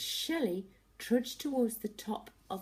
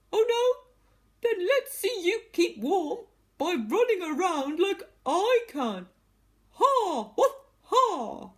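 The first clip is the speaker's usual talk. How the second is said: laughing taunting dog; 13 semitones higher, 12 dB louder than usual. Acted.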